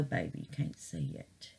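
whispered aside. Real, not acted. Confiding to viewers about camera.

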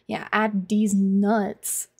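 very slow, drawn-out joke delivery; slow punchline. Shown as a demonstration. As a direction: drag out the punchline. Slow punchline twist on chat.

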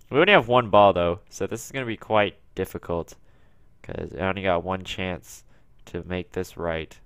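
usual speaking voice

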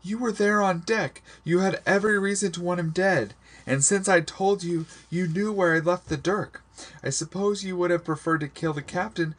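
very high, distraught accusation; high-pitched, overwrought outburst; crying out, rushing on. A narrator's reading. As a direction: pour out the accusations, pitch held way up. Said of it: "I rushed on".

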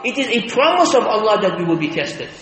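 normal talking voice

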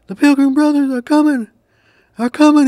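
high, loud frantic turkey voice; panicked turkey cry. A narrator's reading. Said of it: "he cried".

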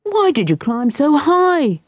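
high call up into the tree. Acted. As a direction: call up to the boy above. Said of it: "called Michael".

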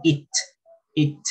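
"It" said as spelled, crisp final t; pronunciation model. Shown as a demonstration. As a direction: say "it" on its own, t released. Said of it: "Este sí es igual".

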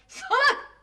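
high, loud outburst; angry insult. Acted. Wife insults husband mid-quarrel, loud angry outburst. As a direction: hurl the insult at him.

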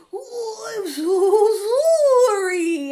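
slow, high, strange voice on "so sorry"; odd voice apology. Retold or imitated. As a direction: apologize in a weird put-on voice. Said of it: "comes up to me and goes".

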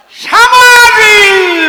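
সামাজি drawn out very slow, high and loud; dialect word shout. Shown as a demonstration. Storyteller showing his home dialect word, long high shout. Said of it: "দাদা, আমরা বলতাম সামাজি".

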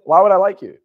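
firm challenge, loud on the first word; pointed, incredulous comeback; blunt retort. Retold or imitated. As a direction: push back bluntly, short of yelling. Blunt retort, first milder take.

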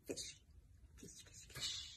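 whispering. Real, not acted. Whispered pointing.

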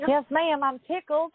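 playful Southern imitation of her; imitating Southern accent. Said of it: "I love her southern accent".

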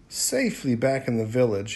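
ordinary delivery

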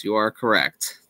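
usual speaking voice

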